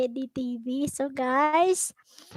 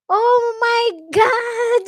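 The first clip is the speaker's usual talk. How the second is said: high, slow laughing exclamation; amused disbelief, laughing through it; laughing oh my God; 7 semitones higher, 7 dB louder, slower than usual. Real, not acted. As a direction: burst out laughing mid-phrase. Laughing shock at livestream comment.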